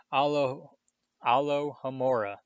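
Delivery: ordinary delivery, nothing marked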